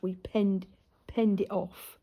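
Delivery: ordinary talk, nothing marked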